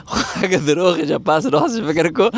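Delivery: words spilling through a laugh. Real, not acted. Teasing her husband about lying, laughing. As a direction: laugh while you tease him.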